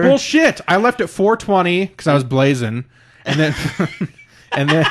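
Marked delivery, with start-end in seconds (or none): 0.0-0.6 loud objection. Real, not acted. Disputes co-host's claim.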